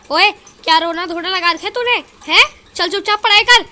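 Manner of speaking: loud, high mother's scolding; angry scolding. Acted. Mother yelling at her crying son, loud and angry. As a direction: yell at the boy to stop crying and study.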